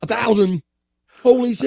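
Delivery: high-pitched, incredulous reaction; shocked, voice jumping up; real astonishment. Real, not acted. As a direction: react in stunned disbelief. Reacting to a huge dose.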